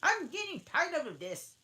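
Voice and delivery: high, loud put-on voice, weary; a fed-up Hare demanding a story; March Hare, bored and grumpy. March Hare yawning at the tea party, high and loud.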